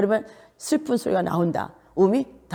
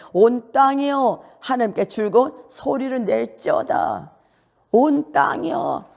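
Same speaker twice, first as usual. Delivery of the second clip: slow, raised preaching declaration; ringing scripture proclamation; proclaimed verse; 5 semitones higher, slower than usual. Real, not acted. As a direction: proclaim it high and drawn out.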